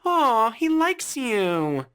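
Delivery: high cooing laugh; laughing mom. Acted. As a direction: laugh it off, then gush slowly and sweetly. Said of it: "She just laughs".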